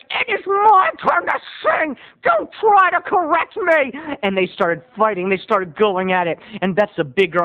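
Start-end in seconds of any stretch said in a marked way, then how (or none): 0.1-3.9 high-pitched mock fury; snippy retort. Regular rebukes rival singer, indignant. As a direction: defend your turn, high and huffy.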